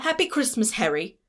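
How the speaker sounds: high-pitched mock-British greeting; bad British accent. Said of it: "can't do a good British accent".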